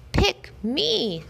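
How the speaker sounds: high, bright begging cry; a puppy begging to be chosen; eager plea. A narrator's reading. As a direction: beg to be picked, pitch way up.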